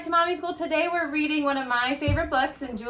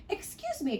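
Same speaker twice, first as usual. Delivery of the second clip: cricket; 10 semitones higher, 6 dB quieter than usual. Acted. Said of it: "coughed a cricket".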